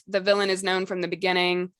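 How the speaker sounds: normal talking voice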